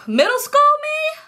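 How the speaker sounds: high, slow, embarrassed squeal; confessing she wasn't cute in middle school; squeaky self-mockery. Real, not acted. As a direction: cringe at your younger self.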